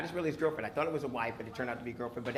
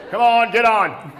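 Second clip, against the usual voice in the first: mock cop on horse; 7 dB louder, slower than usual. Cop on horse offering ride.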